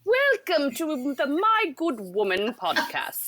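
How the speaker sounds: high, slow, hammed-up British welcome; British accent. Host obliging guest's request for the show welcome, British accent. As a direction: play it grandly British, high and drawn out. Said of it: "say it in a British accent".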